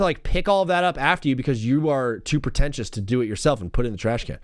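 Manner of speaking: usual speaking voice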